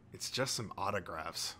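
low, soft, unimpressed teacher; deadpan teacher. A narrator's reading. Unimpressed teacher answers a stunned fan.

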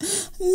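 short, soft, high acted sob; a brief breathy whimper before speaking; crying. A narrator's reading. Zeina starts crying with hands on face, soft acted sob. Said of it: "وبدأت تعيط وقالت".

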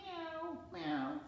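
high squeaky meow; cat meow. Pretend. Grandpa answering what sound a cat makes, high meow. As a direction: meow like a kitten. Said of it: "What sound does a cat make?".

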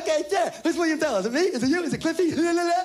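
put-on high, happy childish voice; eager little kid. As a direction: play the clueless kid, all excited. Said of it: "I was like".

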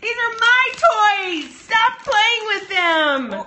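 high, slow mock scold. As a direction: playfully scold the kids. Mom scolding kids over toys.

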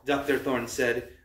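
ordinary delivery

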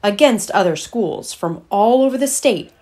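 lively picture-book read-aloud; reading to children. Acted. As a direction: tell it like a storybook to a class.

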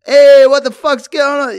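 put-on loud, high drunken shout into the dark room; drunk yell. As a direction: bellow like a drunk bloke barging in. Drunk Pom barging in yelling.

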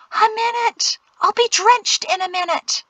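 high, whiny indignation; high-pitched outraged whine; desperate protest. A narrator's reading. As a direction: protest as if about to burst.

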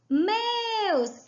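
"Meus" slow, ME stretched long; stress on ME. Shown as a demonstration. Teacher showing the tonic syllable, ME held long in "Meus". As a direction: draw out the vowel of "Meus". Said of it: "é o me".

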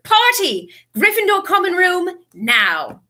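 high, loud party announcement; rally everyone to celebrate; excited calling out. A narrator's reading.

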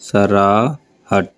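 very slow, every sound drawn out; teacher's slow model of a word; slow demo word. Shown as a demonstration. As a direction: stretch the word out for the class. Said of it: "हवा चलती है sir".

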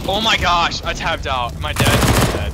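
loud, exasperated disbelief; yelling at his own mistake; frustrated outburst. Real, not acted. Tabbed out mid-round, just died.

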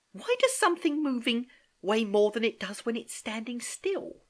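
high, puzzled schoolgirl voice; high-pitched girl working through a puzzle; earnest questioning niece. Acted. Niece asking uncle about getting heavier, puzzled.

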